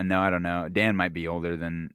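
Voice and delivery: ordinary delivery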